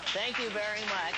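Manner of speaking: radio host impression, put-on timbre; Casey Kasem. Flattered celebrity thanking his impersonator, in imitated voice. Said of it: "Casey Kasem has sent me a gift every time I've done him".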